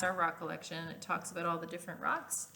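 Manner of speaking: usual speaking voice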